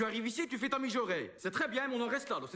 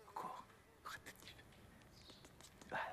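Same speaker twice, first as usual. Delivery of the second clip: whispered, much softer; hushed whisper; 18 dB quieter, faster than usual. Acted. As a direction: murmur close to the model's ear. Photographe murmure pendant la séance. (chuchoté tout bas au modèle)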